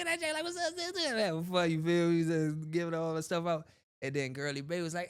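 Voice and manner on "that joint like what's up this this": homies chatter. Retold or imitated.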